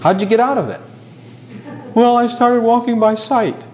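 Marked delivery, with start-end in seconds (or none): 1.9-3.7 higher, slow, a lightly whiny excuse; mock excuse. Preacher mimicking a believer explaining why he lost victory, slow mock whine. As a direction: drag the excuse out a little sheepishly.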